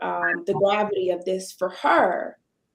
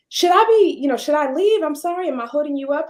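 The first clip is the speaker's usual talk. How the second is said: higher and louder, a flustered artist worried she's in the way; anxious apology; 7 semitones higher, 5 dB louder, faster than usual. Retold or imitated. Nervous painter asking the owner if she should leave, higher and louder. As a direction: rush out a worried, apologetic question. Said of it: "she turns around and she says".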